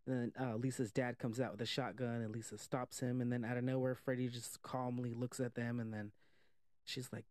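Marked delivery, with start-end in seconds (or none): none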